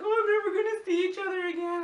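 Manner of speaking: high, wailing mock sob of a tearful goodbye; imitating crying old friends. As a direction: squeeze it up high like an old lady about to cry. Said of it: "they were crying".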